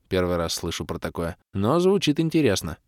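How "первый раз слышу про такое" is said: ordinary delivery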